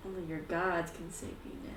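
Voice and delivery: soft, ominous threat; menacing villain. Acted. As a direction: dare them quietly, full of menace. Scythe-bearing villain threatens the party.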